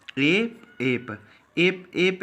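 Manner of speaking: each एप stretched out slowly; slow pronunciation demo. Shown as a demonstration. Teacher modelling the word एप slowly for shorthand students. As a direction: say एप slowly so students catch the sound. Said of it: "अब देखो ये word क्या है".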